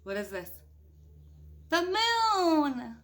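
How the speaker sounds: usual speaking voice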